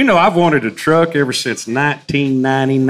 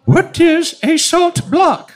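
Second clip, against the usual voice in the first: high, slow puzzled lady; high mock lady; much slower than usual. Mocking a puzzled lady asking about salt blocks. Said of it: "she said".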